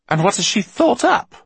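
very high, loud question; anxious demand. A narrator's reading. Eleanor to Basta, afraid of the answer, high-pitched.

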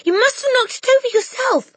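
a whole class shouting back at the headmistress; crying out. Acted. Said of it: "they cried out".

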